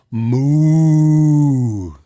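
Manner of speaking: long, loud drawn-out moo; big mooing cow noise. Acted. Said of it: "A cow says".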